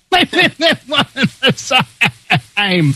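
high, slow words broken by laughter; helpless laughter. Real, not acted. Impressionist cracking up at his own voice, laughing hard. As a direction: laugh right through the words.

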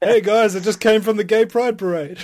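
loud jokey shout mocking himself; mock slag. Guy mocking himself to his mates with a loud jokey shout. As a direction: bellow it like a friend's slag. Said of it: "mock slag of himself".

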